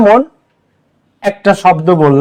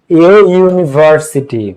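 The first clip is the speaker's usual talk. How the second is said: slow demo; much slower than usual. Shown as a demonstration. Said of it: "তার পূর্বে এ বসে".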